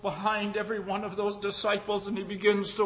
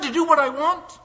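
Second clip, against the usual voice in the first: wondering question; 9 semitones higher, 5 dB louder than usual. Acted. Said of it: "she said".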